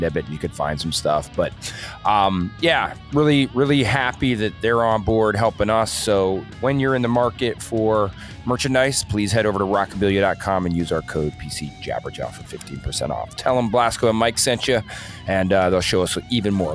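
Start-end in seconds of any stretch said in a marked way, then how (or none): none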